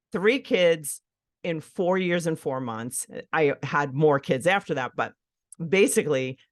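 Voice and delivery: normal talking voice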